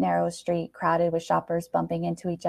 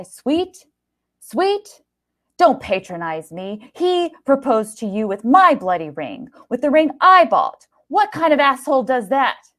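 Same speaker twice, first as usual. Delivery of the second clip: higher, loud furious outburst; 7 semitones higher, 7 dB louder than usual. Acted. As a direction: explode with outrage. Gary explodes in rage.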